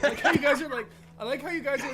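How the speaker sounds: amused laugh through the words. Real, not acted. GM laughing at players' plans.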